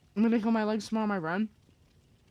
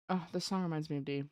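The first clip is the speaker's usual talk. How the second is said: ordinary delivery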